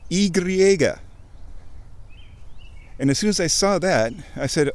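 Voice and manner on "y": Y said as "ee-gree-EH-gah", higher; Spanish name. Shown as a demonstration. Giving the Spanish letter name, Y as ee-gree-EH-gah. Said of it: "In Spanish".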